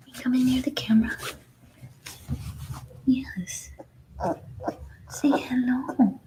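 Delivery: whispered coaxing to a newborn pup; soft whisper to puppy. Real, not acted. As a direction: coax the puppy softly.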